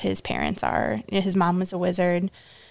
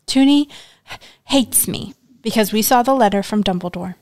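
upset outburst; 3 semitones higher, 7 dB louder than usual. Acted. Upset girl snaps back.

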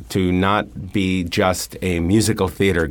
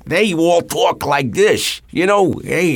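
slow, high, thick New York vet voice; mock thick New York vet, drawn out; vets' New York accent; 10 semitones higher, slower than usual. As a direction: lay on a heavy old New York accent, slowly. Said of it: "had real thick accents".

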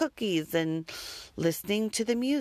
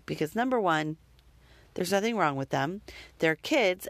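usual speaking voice

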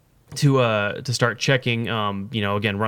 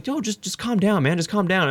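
lightly voiced cop calming; 7 semitones higher than usual. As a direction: settle down a frazzled driver. Cop telling him to calm.

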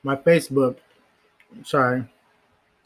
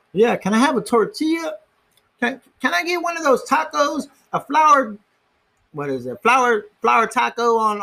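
high put-on customer voice; 10 semitones higher than usual. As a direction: order the tacos in a silly high voice. Voicing a customer ordering.

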